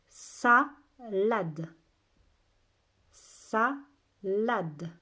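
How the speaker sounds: slow, loud, separated syllables. Shown as a demonstration. Teacher has children listen for A, syllable by syllable. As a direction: sound the word out in two beats. Teacher sounding out word for pupils.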